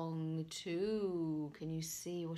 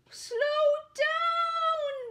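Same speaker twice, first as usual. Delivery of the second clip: high, loud panicked bellow; bellowing knight; 9 dB louder than usual. Acted. Knight on a bucking horse, bellowing. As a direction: yell at the runaway horse. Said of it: "bellowed the knight".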